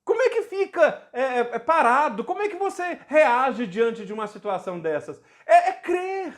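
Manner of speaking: high-pitched, impassioned exclamations; fervent amazement. Real, not acted. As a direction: exclaim it high, carried away by the miracle. Fervent reaction to a retold miracle. (exclamação fervorosa, voz bem aguda)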